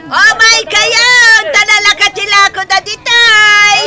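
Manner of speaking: calling out wares. Acted. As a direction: stretch the words out to pull in buyers. Vendor calling customers.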